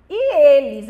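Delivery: sudden loud burst on the words; preaching boom. Real, not acted. Pastor reading Mark aloud to church, loud burst.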